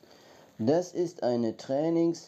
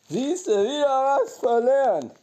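proud high exclaim; 9 semitones higher, 4 dB louder than usual. Real, not acted. Proud comment in high slow voice.

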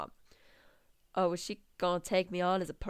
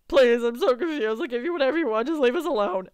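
higher, louder begging; acted pleading; 5 semitones higher, 8 dB louder, faster than usual. Crying man begging to be left alone, loud high plea. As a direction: plead loudly in a raised character voice.

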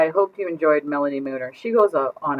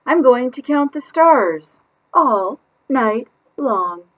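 put-on much higher, slow girl voice; dreamy child; 9 semitones higher, 8 dB louder, much slower than usual. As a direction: kid dreaming aloud. Child announces plan.

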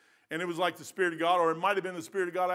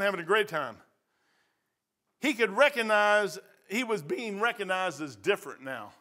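normal talking voice

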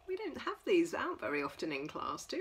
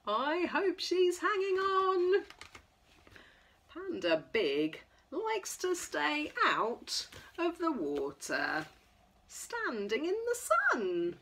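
lively sing-song picture-book reading; reading to children; 5 semitones higher, 4 dB louder, slower than usual. Acted. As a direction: read it bright and playful for toddlers. Reads picture book to toddlers.

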